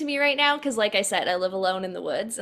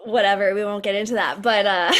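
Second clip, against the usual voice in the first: ordinary talk, nothing marked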